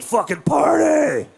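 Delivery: high, slow, drawn-out party shout; drunk party yell. Retold or imitated. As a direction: bellow it like a shirtless drunk at cops. Drunk friend yelling at cops.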